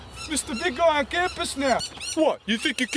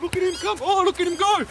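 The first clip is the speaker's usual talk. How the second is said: excited commentary; 4 semitones higher than usual. Acted. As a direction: call the run like a fan.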